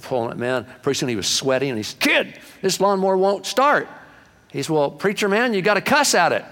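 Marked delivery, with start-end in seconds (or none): 2.0-3.9 frustrated pastor. Retold or imitated. Pastor frustrated at stubborn lawnmower. 4.7-6.4 put-on cocky young man. Young man advises the preacher.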